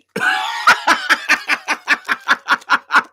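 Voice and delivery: long, high, loud cackling laugh; hard laughter. Real, not acted. Amused by their own realisation, long loud laughter. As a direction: crack up helplessly, high and loud.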